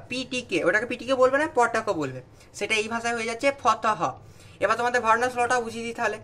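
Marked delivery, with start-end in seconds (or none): none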